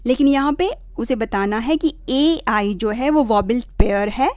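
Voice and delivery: normal talking voice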